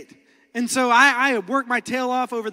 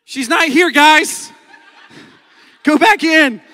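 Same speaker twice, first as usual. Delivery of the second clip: loud, higher, hurried cover-up; panicked shout; 4 semitones higher, 10 dB louder, slower than usual. Retold or imitated. Embarrassed teen turning classmates away, panicked shout. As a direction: shout them back inside before they see.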